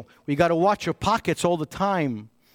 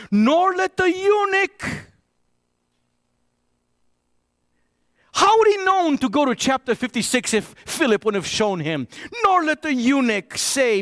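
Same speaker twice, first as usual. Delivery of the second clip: scripture preaching; 6 semitones higher than usual. Acted. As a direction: proclaim the prophet's words to the congregation. Reads Isaiah at full force.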